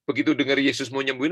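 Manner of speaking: normal talking voice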